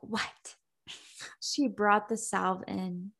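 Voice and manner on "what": whispered what. Retold or imitated. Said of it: "he was like".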